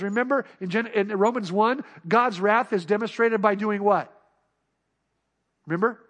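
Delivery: ordinary talk, nothing marked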